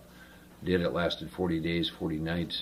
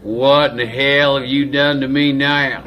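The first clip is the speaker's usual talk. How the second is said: loud, high, drawn-out wail; hammed-up bewildered cry; tearful slow-talking Texan; 7 semitones higher, 10 dB louder than usual. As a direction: imitate a big man bawling slowly. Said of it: "he's got just crocodile tears just pouring down".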